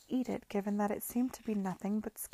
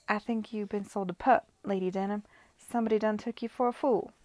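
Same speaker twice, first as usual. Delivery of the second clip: light Caribbean patois, louder; broad patois; 5 dB louder than usual. Acted. Miss Lamb needling Lady Denham in a light Caribbean patois. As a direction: keep the broad West Indian patois going. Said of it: "not in her usual voice, but a broad patois".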